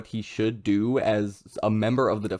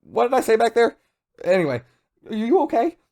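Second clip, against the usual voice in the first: frantic, much higher and louder babble; freaking out; 13 semitones higher, 5 dB louder, faster than usual. Retold or imitated. Furuhashi freaking out as his buddy wakes up, frantic high babble. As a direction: gush over your buddy in a panic. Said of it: "Furuhashi's there freaking out like".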